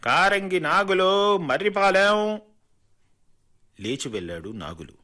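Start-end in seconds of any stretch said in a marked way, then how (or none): 0.0-2.4 calling out a name. A narrator's reading. Clerk calls next name aloud.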